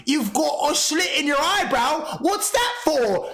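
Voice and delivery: slow, high mocking impression; Daz. Streamer ridiculing the only comeback Daz had, mocking impression.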